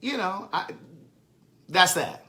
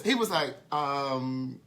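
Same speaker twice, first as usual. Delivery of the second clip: usual speaking voice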